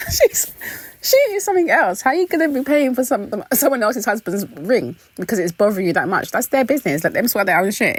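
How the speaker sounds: high, laughing through the words; amused disbelief. Real, not acted. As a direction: still cracking up, scolding with a laugh. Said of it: "It just made me laugh".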